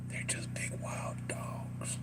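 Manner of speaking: whispered under the breath. Real, not acted. Streamer to chat about bears, whispering.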